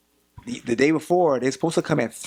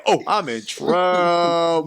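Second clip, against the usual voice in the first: dread; 7 semitones higher, 3 dB louder, much slower than usual. Retold or imitated. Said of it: "I said".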